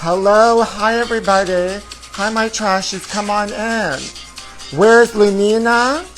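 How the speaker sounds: high, campy hostess greeting; sassy diva welcome; sassy drag queen. Acted. Host greets viewers in persona.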